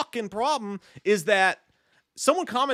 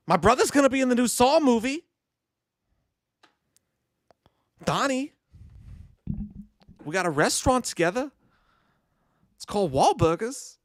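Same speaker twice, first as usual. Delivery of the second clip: Mark Wahlberg impression; 5 semitones higher than usual. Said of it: "do Mark Wahlberg".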